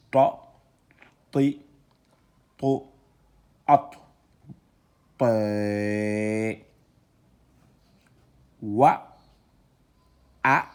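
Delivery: loud, drawn-out syllables read one by one; syllable reading drill. Shown as a demonstration. Teacher spelling out syllables loudly for students. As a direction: sound out each letter for the class. Teacher sounds out Arabic letters.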